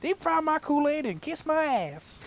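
high, slow hammed-up yokel; folksy exclamation. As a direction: exclaim like a hammy country bumpkin. Same put-on voice again.

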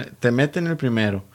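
ordinary talk, nothing marked

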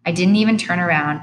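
plain reading voice

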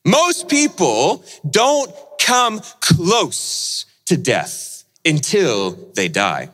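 ordinary delivery

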